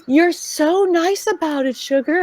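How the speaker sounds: high, slow sugary voice; sweet high voice. Retold or imitated. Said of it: "they're usually like".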